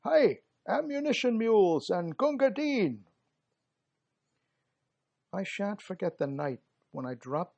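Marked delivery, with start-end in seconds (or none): 0.0-3.1 high, loud battlefield shout; front file shout. Acted. Front-line soldier shouting for ammo mules and water, loud. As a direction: bellow down the line for ammunition. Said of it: "you could hear the front file shout".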